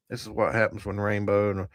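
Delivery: normal talking voice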